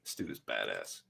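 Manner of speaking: usual speaking voice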